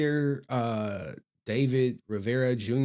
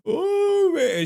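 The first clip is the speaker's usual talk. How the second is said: high, loud laughing burst; high cackling laugh into "oh man"; big laugh; 4 dB louder than usual. Real, not acted. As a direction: laugh out loud, then exclaim.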